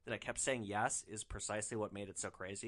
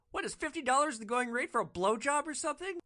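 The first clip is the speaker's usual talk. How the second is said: high, loud disbelieving outburst; can't believe what he's hearing; incredulous; 12 semitones higher, 6 dB louder than usual. Retold or imitated. Driver reacting to the price, loud incredulous. Driver's shocked inner thought.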